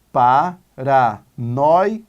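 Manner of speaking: "Paranoico" very slow, each syllable held apart; separated into syllables. Shown as a demonstration. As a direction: sound out "Paranoico" as pa, ra, noi, co. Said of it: "Vamos separar em sílabas". ("Paranoico" bem devagar, separando as sílabas)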